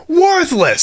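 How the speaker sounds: high, disgusted outburst; exasperated character dismissing it; upset. Acted. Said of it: "upset he wasted his time".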